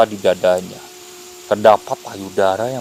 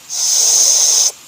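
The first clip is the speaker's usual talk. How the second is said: loud drawn-out shush; creature's lingering hush; long hushing; 4 dB louder than usual. A narrator's reading. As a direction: hiss a long "sssst" for silence. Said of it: "sambil berucap".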